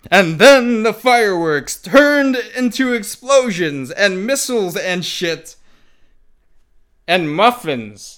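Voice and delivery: high, loud breathless excitement; excited. Acted. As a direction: build it up like an action climax. Reading climax with rising excitement.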